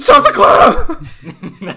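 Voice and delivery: high, very slow, loud plea; exasperated. Retold or imitated. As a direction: wail on your last nerve. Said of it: "imagining a super exasperated".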